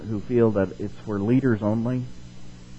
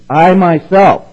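slow, much higher and louder; solemn proclamation; 7 semitones higher, 12 dB louder, much slower than usual. Acted. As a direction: declare it like God himself. Voicing God's declaration.

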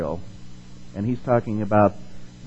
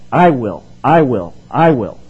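each I will hit louder; emphatic; 8 dB louder, slower than usual. Acted. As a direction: repeat it with growing insistence. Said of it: "the Lord is emphatic".

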